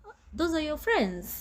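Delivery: mock disbelief. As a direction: act out someone questioning the company kept. Acts out onlookers judging friends.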